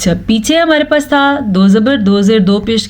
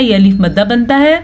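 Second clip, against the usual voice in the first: ordinary delivery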